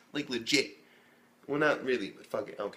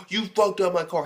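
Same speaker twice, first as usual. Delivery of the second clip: mock angry car owner; 7 semitones higher, 6 dB louder than usual.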